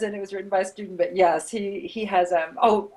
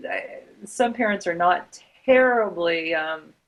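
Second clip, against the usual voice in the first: usual speaking voice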